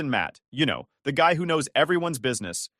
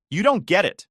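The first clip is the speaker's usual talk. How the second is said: sharp, higher and louder snap; snapped; 7 semitones higher, 7 dB louder than usual. Acted. Sister snapping back at brother, sharp and raised. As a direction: bite the words off at her brother. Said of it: "she snapped".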